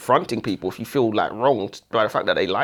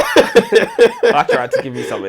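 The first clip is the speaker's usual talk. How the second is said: slow, high, laughing mock whine; mock hurt reply; 4 dB louder, slower than usual. Reader answering the author in a mock-wounded voice, slow, high and laughing. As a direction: pretend to be wounded while laughing. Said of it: "Then I'd just be like".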